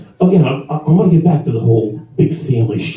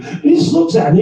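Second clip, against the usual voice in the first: ordinary talk, nothing marked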